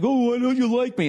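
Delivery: hammed-up pleading voice; mock needy plea. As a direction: whine it like a hurt fan begging for approval. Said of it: "To be like".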